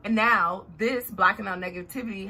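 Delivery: usual speaking voice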